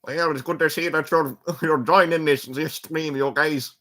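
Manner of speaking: put-on Scottish accent. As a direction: welcome the stream in a Scottish brogue. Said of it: "like, a Scottish accent".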